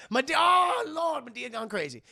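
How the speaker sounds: high, loud wail easing off; mock alarmed cry. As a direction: cry out in exaggerated dismay. Acting alarmed cry about Madea.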